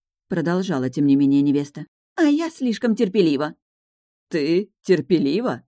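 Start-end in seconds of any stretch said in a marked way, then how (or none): 2.2-3.5 higher, aggrieved; self-pitying. Acted. Fiancée quarrels. 4.3-5.6 slow, disbelieving question; incredulous. Acted. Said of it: "потерял часть отрешённости".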